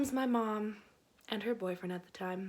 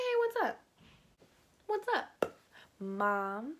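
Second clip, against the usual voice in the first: fake innocent. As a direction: play it breezy and innocent. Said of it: "I was like".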